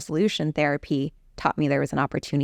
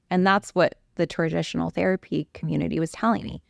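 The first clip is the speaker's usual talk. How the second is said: usual speaking voice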